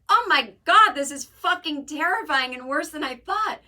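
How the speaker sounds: loud, high hammed-up panic; mock terror. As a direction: burst out loudly in comic horror. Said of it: "we'll be like".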